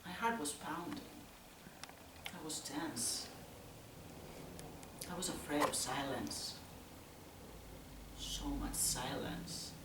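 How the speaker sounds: very soft and low, with long pauses; hushed dread. Acted. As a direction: barely dare to break the silence. Afraid of silence, waiting tensely.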